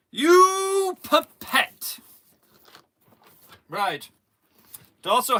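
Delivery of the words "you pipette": long high wailed "you", then punched word; hammed-up outrage, hugely drawn out; mock insult. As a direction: hurl it as a theatrical, loud insult. Acts out the road insult.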